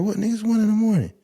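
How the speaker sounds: mock snapping. Imitating a woman snapping at a late-night caller, high and loud. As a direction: bark back like a fed-up woman.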